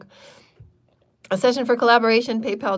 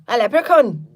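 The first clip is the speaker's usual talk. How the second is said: put-on loud, higher leprechaun-style Irish accent; attempted Irish accent; 4 semitones higher, 8 dB louder than usual. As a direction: belt it out in a hammed-up Irish brogue. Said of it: "what the hell is an Irish sound?".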